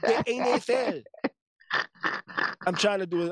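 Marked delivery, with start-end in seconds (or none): 0.0-1.0 higher-pitched put-on Spanish accent; joking Spanish-accented N F L. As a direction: imitate an old Spanish uncle's accent. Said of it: "I'm trying to do my Spanish accent".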